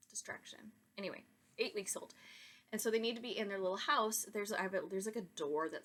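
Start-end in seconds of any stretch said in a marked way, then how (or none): 0.1-0.6 a quick whispered aside under the breath. Real, not acted. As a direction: drop to a whisper for a side remark. Aside about a distraction.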